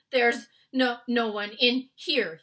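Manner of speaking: frightened giant stammering. Acted. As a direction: stumble nervously over the words. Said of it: "he stammered".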